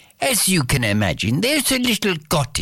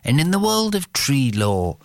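ordinary delivery

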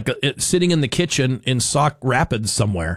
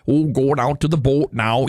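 a light mock Minnesota accent; joking Minnesota accent about going out to the boat. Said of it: "they don't have a Southern twang".

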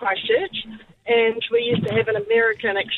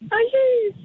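stunned disbelief; 13 semitones higher than usual. Retold or imitated. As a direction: gasp out the question as she recognises her. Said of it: "and I was like".

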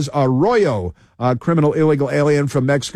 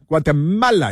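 mock Spanish accent. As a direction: mockingly say Guatemala in a Latin American Spanish accent. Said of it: "You have to say it with an accent".

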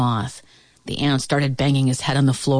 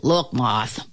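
lower, restrained irritation; controlled temper; 4 semitones lower than usual. A narrator's reading. Ant addressing the moth, trying to control his temper. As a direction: hold the temper in. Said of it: "trying to control his temper".

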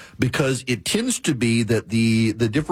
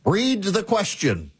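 lightly voiced stern professor, much higher; 9 semitones higher than usual. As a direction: imitate the professor calling on a student, mildly mock-stern. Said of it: "He said".

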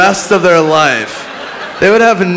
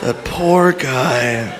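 very slow, lower murmur of sympathy; subdued mock pity; 4 semitones lower, 4 dB quieter, much slower than usual. Comic mourns the imagined man, slow subdued mock pity. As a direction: let it sink, long and low. Pity repeated after audience laughs.

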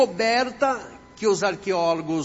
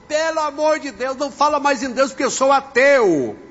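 high, raised voice in exasperated protest; indignant complaint, getting louder to the end; outraged atheist; 5 semitones higher, 6 dB louder than usual. Retold or imitated. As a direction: act out a fed-up passenger's outburst. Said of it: "Aquele homem ficou tão revoltado".

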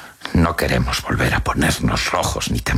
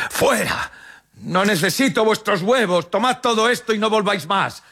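shouting. A narrator's reading. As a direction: order them out in a high, strained cry. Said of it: "y grita". (grito agudo de tendero enfadado)

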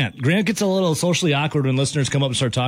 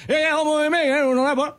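put-on Aussie accent; 13 semitones higher than usual. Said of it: "What's wrong with my accent?".

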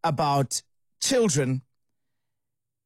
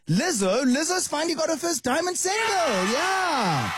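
high, celebratory whoop at the end; thrilled, high-pitched hurrah; excited cheer; 8 semitones higher than usual. Real, not acted. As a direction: announce it like a big win, voice soaring. Cheering [name]'s first diamond single.

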